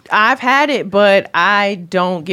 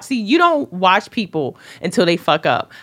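ordinary delivery

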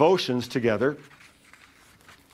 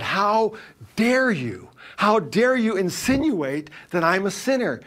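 higher, indignant mock fury; retold outrage; 6 semitones higher than usual. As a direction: voice her affronted rage. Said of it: "almost shaking with, with rage".

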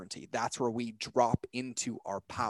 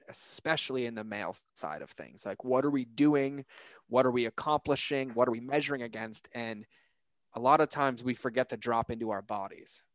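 ordinary talk, nothing marked

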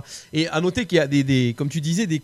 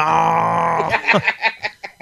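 gros rire qui part en cri; éclat de rire. Real, not acted. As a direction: rire fort et bruyant. Reacts to the Nadal joke. (grand éclat de rire)

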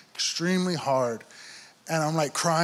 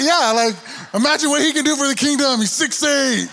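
higher and louder, quick words bubbling with laughter; laughing through it; 9 semitones higher, 5 dB louder than usual. Real, not acted. Retelling how the church eyed the tall kid, laughing. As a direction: rattle it off while cracking up.

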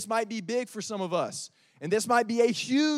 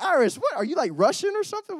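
high, louder teasing disbelief; amused surprise; 8 semitones higher, 3 dB louder than usual. Retold or imitated. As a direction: react like the accent caught you off guard. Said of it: "And we're like".

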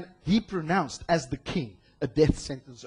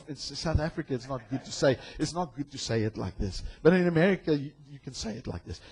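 usual speaking voice

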